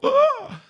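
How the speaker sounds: a sudden high, loud yelp of panic; panicked cry. Retold or imitated. Retelling a panicked woman's sudden alarmed cry. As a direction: squeal like you just remembered disaster.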